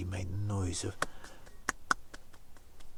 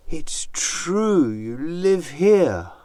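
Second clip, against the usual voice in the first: slow high spidery flattery; 8 semitones higher, 11 dB louder, slower than usual. Acted. Anansi flattering the snake.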